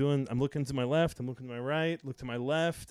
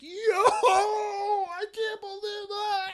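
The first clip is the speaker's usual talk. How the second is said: crowd going wild; 8 dB louder, much slower than usual. Retold or imitated.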